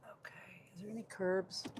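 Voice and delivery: soft unvoiced whisper. Real, not acted. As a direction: whisper it to yourself. Whispering while driving.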